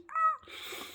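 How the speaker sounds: very high, thin squeal; happy squeal. Real, not acted. Thrilled that their voice has changed, high squeal. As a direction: let out a high little squeal of delight.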